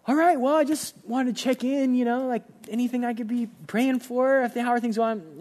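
trying hard to sound friendly; forced cheer. Retold or imitated. Pastor voiced on phone call.

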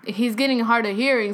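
ordinary delivery

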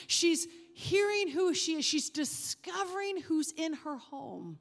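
loud, high, driving sermon voice; full-force preaching. Acted. Preacher driving home the story of Martha to the congregation, loud and fervent. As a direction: preach it hard, building the story.

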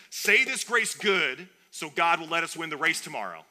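loud, high, bad Southern accent; terrible Southern accent. Fed-up wife demanding a good grace, bad Southern accent. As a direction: put on a hammed-up Southern twang. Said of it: "I have a terrible Southern accent".

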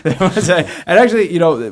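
laughing. Real, not acted. Answering the co-host's Twitter joke, laughing. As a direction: talk through a real laugh.

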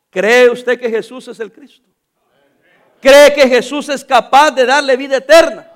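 loud pulpit appeal; full-force preaching. Acted. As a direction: press the altar call on the hearers. (predicación a todo pulmón)

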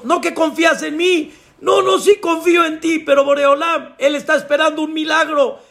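high-pitched, heated exchange; urgent arguing. Acted. God challenges climber who still clings, urgent high argument. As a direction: argue insistently, pitched high.

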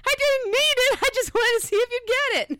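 high, loud cartoonish prankster voice; Rocket. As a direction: crow about your prank, high and loud.

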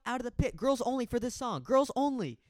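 high, loud call over the pit; crowd call. Retold or imitated. Calling girls into the pit for this song, high loud shout.

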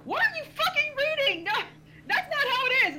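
indignant high loud yell; yelled outburst. Retold or imitated. Heckler at a panel objecting to the host, indignant yell. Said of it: "and I yelled".

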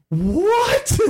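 very high, outraged mock gasp of a word; imitating scandalized players; indignant. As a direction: squeal it in disbelief. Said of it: "super indignant about it".

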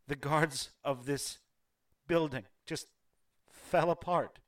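a widow holding herself together, barely; grieving half-elf noblewoman. Acted. Half-elf widow telling the visitor of the night's horrors, bitter grief.

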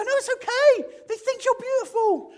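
very high, loud gushing; excited reassurance. Pretend. Mate telling the friend they're beautiful, squealing high and loud. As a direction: bubble over with good news.

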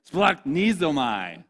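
"Splachthiznomai" very slow with a throaty kh; slow, guttural "Splachthiznomai"; phlegmy Greek. Shown as a demonstration. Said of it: "the more phlegm you have in it".

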